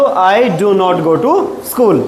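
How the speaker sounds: very slow, stretched-out model sentence; slow modelling. Shown as a demonstration. Teacher giving pupils the negative answer, slowly drawn out. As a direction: draw out each word for learners.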